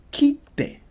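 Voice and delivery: "Kite" as kit-te with a held stop; "Kite" said high, pausing on the double t; "Kite" with a gap before te; double consonant. Shown as a demonstration. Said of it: "So you're pausing between it".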